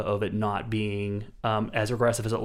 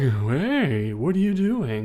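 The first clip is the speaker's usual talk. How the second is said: higher mock alarm; alarmed question; 3 semitones higher, 4 dB louder, much slower than usual. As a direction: catch her in the act. Husband sees evidence burning.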